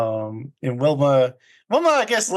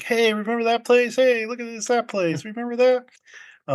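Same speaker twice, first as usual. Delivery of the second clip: mock excited; 8 semitones higher than usual. Soldiers pointing out hometown sights.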